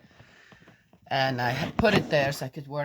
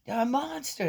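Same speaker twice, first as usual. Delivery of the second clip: put-on much higher child saying monster; granddaughter's voice; 10 semitones higher than usual. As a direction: say it like a kid spooked by monsters. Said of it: "of what are you actually scared".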